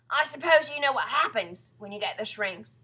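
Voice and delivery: loud, dramatic lead-up; menacing husband. Acted. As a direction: frighten her with a leading question. Husband frightens wife in story.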